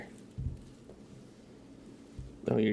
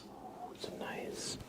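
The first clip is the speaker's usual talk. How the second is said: ASMR whisper; 7 semitones higher than usual. Acted. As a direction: whisper intimately while brushing. ASMR brushing and chat.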